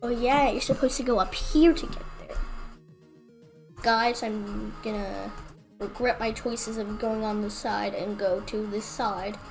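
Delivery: ordinary talk, nothing marked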